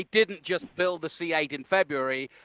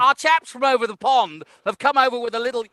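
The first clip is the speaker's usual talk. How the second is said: higher, louder plummy English commentator; patronizing English pundit, pitched up and louder; mock English commentator; 5 semitones higher, 5 dB louder than usual. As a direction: ham up a condescending British pundit. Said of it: "It's all written by English people".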